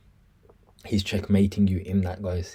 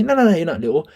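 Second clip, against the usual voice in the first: imitated protest; 8 semitones higher, 7 dB louder, faster than usual. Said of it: "he's gonna say".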